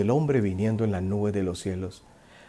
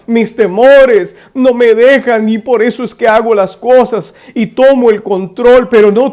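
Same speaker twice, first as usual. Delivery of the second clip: much higher, loud frantic begging; panicked pleading; 10 semitones higher, 10 dB louder than usual. Retold or imitated. Frightened driver begging Jesus to take the wheel, panicked. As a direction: plead in a rush of nerves. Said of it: "Y di".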